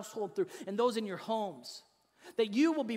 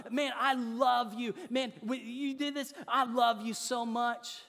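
loud, higher, drawn-out praise; gushing parent; 4 semitones higher, 4 dB louder than usual. Acted. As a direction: act out an overexcited proud parent. Mimics parent praising achievement with love.

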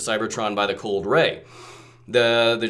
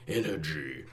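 unearthly voice; much slower than usual. Acted. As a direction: groan it out like a hungry ghost. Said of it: "ghostly voice of Ingester greedily".

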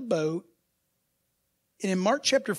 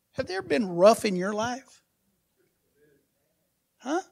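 ordinary talk

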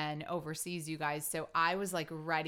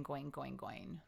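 each "going" softer and lower; fading away; 3 semitones lower, 10 dB quieter, slower than usual. Shown as a demonstration. Wife describing the nonstop trip, trailing off quietly.

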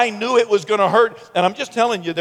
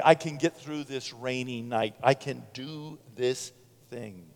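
soft, low self-encouragement; quiet resolve; 6 semitones lower, 10 dB quieter than usual. Acted. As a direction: say it softly to yourself, slowing down. Quiet self-encouragement after psalm.

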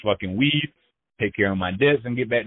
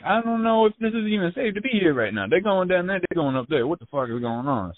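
panicky; 9 semitones higher than usual. Retold or imitated. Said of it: "Yeah, I'm like".

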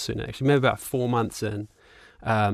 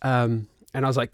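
ordinary talk

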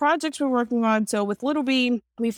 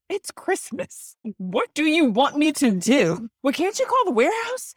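exasperated; 6 semitones higher than usual. Retold or imitated. Publisher retelling a reply to a Christmas caller, exasperated. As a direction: plead in mock disbelief, stretching the words.

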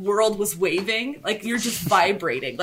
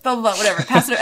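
ordinary talk, nothing marked

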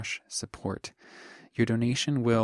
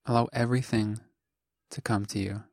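calm meditation voice, short phrases with long pauses; guided meditation. Acted. Teacher guides listeners into just being, calm meditation voice. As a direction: guide the listener gently, leaving long silences between phrases.

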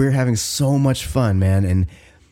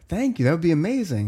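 higher, overly grateful mock politeness; gushing thanks; 7 semitones higher than usual. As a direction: gush thanks with a lifted voice. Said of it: "And I was like".

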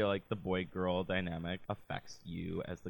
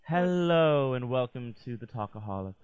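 show host intro; 3 semitones higher, 7 dB louder than usual. Acted. Podcast show opening.

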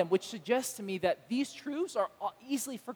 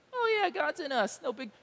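bored shrug; 6 semitones higher than usual. Retold or imitated. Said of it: "churchgoers shrug it off".

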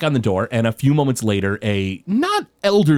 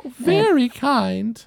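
put-on high, slow elderly woman; an old lady fondly vouching for Fritz; 10 semitones higher, slower than usual. Old woman praising Fritz, high slow voice.

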